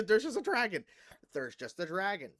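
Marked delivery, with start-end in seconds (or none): 0.0-0.8 loud, high, laughing exclamation; real incredulous laugh through the words; laughing disbelief. Real, not acted. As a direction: burst out laughing at the absurdity.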